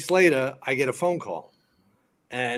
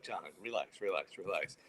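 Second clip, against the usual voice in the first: low, soft hushing of a friend; calming down; 5 semitones lower, 13 dB quieter than usual. Retold or imitated. Fireman soothes his yelling drunk friend, quiet calming. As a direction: talk him down quietly, voice dropped.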